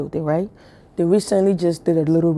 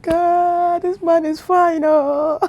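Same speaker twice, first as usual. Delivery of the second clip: much higher, slow, near-whimpering swoon; swooning gush; 12 semitones higher, 3 dB louder, much slower than usual. Retold or imitated. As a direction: gush like he's too fine to bear. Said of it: "I'm like".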